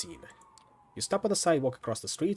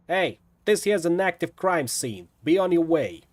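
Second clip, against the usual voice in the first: put-on loud, slow Brooklyn accent, higher; Brooklyn cop yell; 5 semitones higher, 7 dB louder, slower than usual. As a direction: bark it out like a Brooklyn beat cop. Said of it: "yells in a thick Brooklyn accent".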